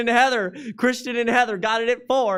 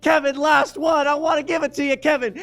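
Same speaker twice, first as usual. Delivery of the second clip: urgent call; 5 semitones higher, 5 dB louder than usual. Real, not acted. Countdown urging bidder.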